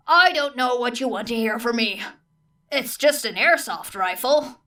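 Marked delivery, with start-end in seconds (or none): none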